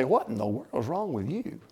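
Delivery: low, hushed, disdainful scolding; self-righteous. Acted. Churchgoer to an expressive worshipper, quietly self-righteous. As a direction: look down your nose and say it under your breath. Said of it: "look down our self-righteous noses and say".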